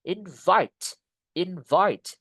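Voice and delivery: "Invite" with a punched, falling "vite"; stress on second syllable. Shown as a demonstration. Teacher drilling a verb, stress on "vite" in "Invite". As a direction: hit "vite" in each "Invite". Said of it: "penekanannya di suku kata yang kedua".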